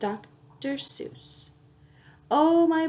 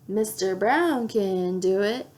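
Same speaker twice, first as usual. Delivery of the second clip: ordinary delivery, nothing marked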